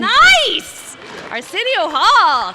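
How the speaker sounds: high, full-volume whoop of approval; real excited shout; excited cheer. Real, not acted. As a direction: holler it out to the crowd, stretching the words. Cheering along with audience whoops.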